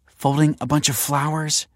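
scoffing. A narrator's reading. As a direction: brush it off with scorn. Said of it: "scoffed Olive".

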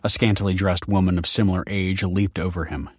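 ordinary delivery, nothing marked

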